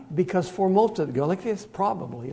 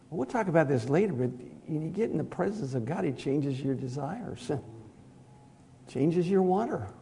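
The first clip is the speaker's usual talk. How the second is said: ordinary talk, nothing marked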